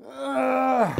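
loud pained groan; exhausted groan. Real, not acted. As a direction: groan from tired muscles. Exhausted after set.

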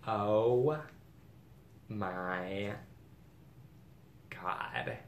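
drawn-out awe. Real, not acted. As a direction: let each word hang, dropping away in amazement. Overwhelmed on revealing the bag.

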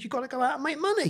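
mum's voice, pitched well up and quick; mum's advice. Retold or imitated. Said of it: "My mum said".

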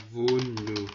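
ordinary delivery

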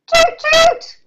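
high toot toot; boat horn toot. Shown as a demonstration. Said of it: "What does a boat do?".

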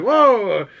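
a high shocked whoa; mock shocked. Reacting to the millionaire's line, high shocked whoa. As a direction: gasp out whoa at the wild line. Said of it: "and you're like".

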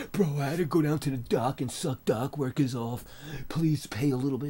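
lightly voiced whiny plea; a hint of a begging tone; mock pleading owner. Mocking the server owner.

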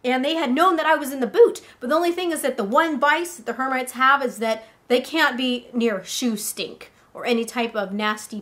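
ordinary delivery, nothing marked

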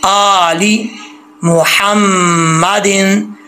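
slow मुहम्मदिन, light throat हा; slow recitation. Shown as a demonstration. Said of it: "इस हा का ख्याल रखना है".

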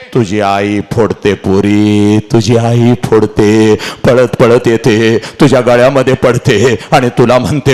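low, slow lamenting narration; tearful storytelling tone; sorrowful oration. Acted. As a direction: draw out each phrase mournfully.

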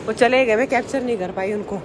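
ordinary delivery

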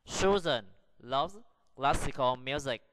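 slow, deliberate reading, pausing between words; slow model sentence. Shown as a demonstration. Teacher repeats sentence one so pupils listen again, slow and deliberate. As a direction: sound it out word by word for learners. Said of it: "Now listen again".

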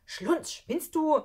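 high, loud, drawn-out hissed rebuke; loud, exasperated outburst; angry snapping. Acted. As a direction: snap at a friend in outrage. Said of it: "dann fauchte er Schlunz an".

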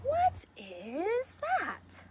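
slow, wondering donkey voice; drawn-out puzzled question. Acted. Storyteller to children, slow puzzled voice.

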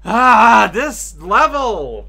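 loud, slow groan at the level; exasperated outburst. Real, not acted. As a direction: vent at the level, dragging it out. Frustrated at level.

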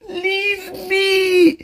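a sobbing, high-pitched plea to be left alone; crying shout. Real, not acted. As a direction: cry out "Leave me" high, in tears.

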